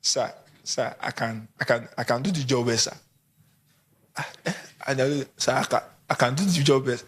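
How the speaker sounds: nervous job applicant. Acted. Job seeker pleading with the boss, low and nervous. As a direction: stutter out the promise, scared. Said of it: "and you are shivering".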